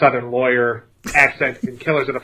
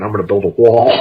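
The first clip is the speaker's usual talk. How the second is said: mock politician voice, lower in pitch; hammed-up political impression, pitched down; Trump; 5 semitones lower, 4 dB louder than usual. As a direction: put on a jokey low campaign-promise voice. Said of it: "wanted to make this Trump".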